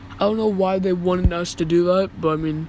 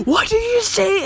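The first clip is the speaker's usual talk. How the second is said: high-pitched mock shriek, a little louder; imitated scream; 13 semitones higher, 4 dB louder than usual. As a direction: shriek it in imitation of an outraged woman. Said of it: "she was screaming at me like".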